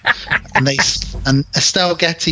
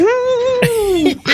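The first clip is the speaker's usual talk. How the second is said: very high falsetto "woo"; gleeful squeal like a kid doing a wheelie; long, high imitated whoop; mock whoop.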